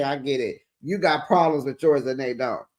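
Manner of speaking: ordinary delivery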